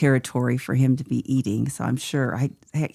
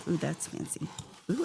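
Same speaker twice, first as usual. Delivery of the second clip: usual speaking voice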